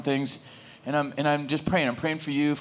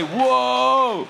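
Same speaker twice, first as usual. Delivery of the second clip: high, loud, held exclamation; drawn-out whoa; 9 semitones higher, 7 dB louder than usual. Shown as a demonstration. Preacher prompts the congregation to react, big high whoa. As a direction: belt out an impressed whoa.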